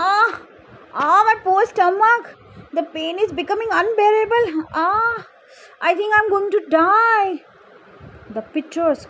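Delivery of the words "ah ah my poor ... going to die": high, agonized storybook wail; screaming in pain. A narrator's reading. Pitcher cries out that it is dying, high pained screaming. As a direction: cry out as if dying of bellyache. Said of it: "screaming at the top of its voice".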